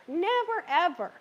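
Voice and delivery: "Never" jumps high, then "ever" follows, both hit hard; stress on never ever. Shown as a demonstration. Cooking host warns viewers off plaster-like roux, stressing "Never, ever". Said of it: "You never want it to look like plaster".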